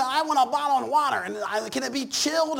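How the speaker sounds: put-on fussy kid. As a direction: play the spoiled child asking. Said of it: "Them kids are gonna say".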